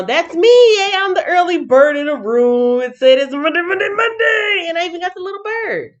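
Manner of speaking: high, loud, gleeful squeal; excited cheer. Real, not acted. Cheers being the early bird.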